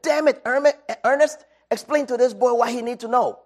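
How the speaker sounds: cussing out her husband. Retold or imitated. Wife turns on husband Ernest in front of his son, scolding loudly. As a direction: snap at the husband like a fed-up wife who finally speaks up. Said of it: "I've never heard a woman talk like that".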